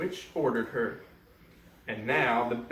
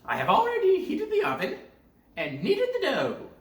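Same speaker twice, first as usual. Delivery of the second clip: scheming witch; 5 dB louder than usual. Acted. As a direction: savour the words like a plotting witch. Witch speaks.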